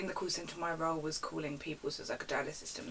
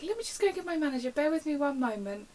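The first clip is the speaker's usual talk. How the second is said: high, quick, polite customer-service voice; imitated eager-to-please call handler; placating phone voice; 8 semitones higher, 5 dB louder than usual. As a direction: ask an angry caller to hold, sweetly. Polite phone handler fetching manager.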